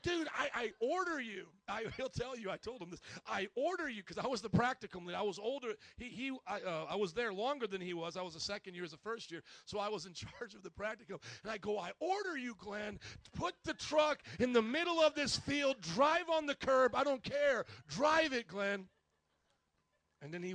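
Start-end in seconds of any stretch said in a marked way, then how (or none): 0.0-1.5 commanding retort. Retold or imitated. Retold order in a quarrel. 3.3-4.0 commanding order. Retold or imitated. Repeats his retold order. 11.8-18.9 high, loud, exasperated command; retold argument, acted at a real yell; yelled order. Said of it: "Glenn and I would yell at each other".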